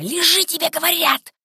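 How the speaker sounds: high, urgent hissed command; frantic whisper-shout. A narrator's reading. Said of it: "страшным шёпотом закричала Женька".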